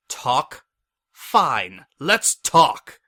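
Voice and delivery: building to an enraged roar; furious shout. Acted. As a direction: explode on let's talk. Furious prisoner explodes.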